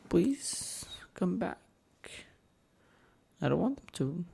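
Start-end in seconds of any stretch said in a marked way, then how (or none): 0.1-1.6 soft pleading whisper. Pretend. Pleading alone.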